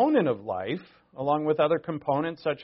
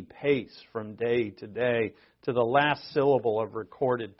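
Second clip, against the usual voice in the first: poem recitation; 6 dB louder than usual. Acted. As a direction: give Macbeth's lines measured dramatic weight. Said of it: "this is from Shakespeare's Macbeth".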